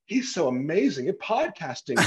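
high, slow, swooning fan gush; hammed-up fangirl swoon over the podcaster; mock gushing fan. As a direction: gasp and gush like a starstruck fan. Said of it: "trying to have an impact of like".

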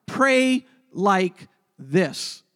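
each word drawn out slowly and firmly; PRAY, like, this: slow and weighty; slow, stressed. Shown as a demonstration. Pastor telling note-takers the key line, very slow. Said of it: "write this down".

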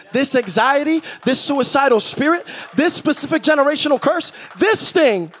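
high, building preacher's list; full-force preaching. Acted.

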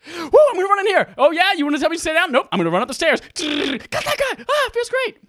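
high, loud and fast cocky gamer voice. Retold or imitated. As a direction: play the reckless show-off rushing in. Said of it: "I'm just going like".

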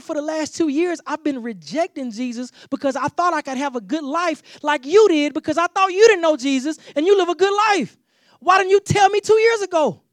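building to a loud, high shout on the last question; livid shout. Retold or imitated. Employee shouting at his boss who hid his faith, furious. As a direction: let the hurt boil into a full yell. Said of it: "He was livid".

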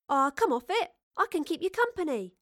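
high, breezy brush-off; cajoling. Acted. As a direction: talk them round lightly. Astrid cajoles the reluctant professor.

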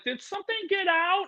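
high squeaky worried question; mock worried viewer. Mocking an alarmed parent on the call, high and squeaky. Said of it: "They're like".

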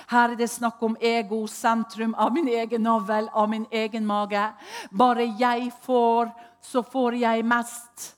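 fiery preacher mocking ego; preaching loud. Real, not acted. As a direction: hammer the point home, stretching the last words. Preaching against self-centred yoga.

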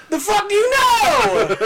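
high, loud and slow, faking outrage; hammed-up indignant shout; mock outraged yell. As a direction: yell it back in mock disbelief, stretched out. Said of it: "And I was all".

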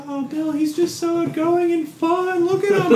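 mock gushing relatives. Family gushing over drunk Bill.

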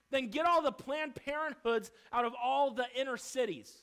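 loud, forceful pulpit preaching; a preacher raising his voice at the congregation; fiery preaching. Acted. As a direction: thunder it from the pulpit.

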